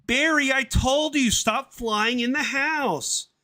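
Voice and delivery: very high, loud, then slow telling-off; scolding cartoon mum. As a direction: scold the son squeakily.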